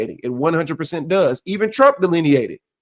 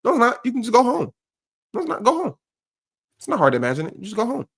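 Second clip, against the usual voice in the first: mocking retort; 7 semitones higher, faster than usual. Real, not acted. Mocking the crying mother.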